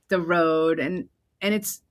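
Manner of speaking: ordinary talk, nothing marked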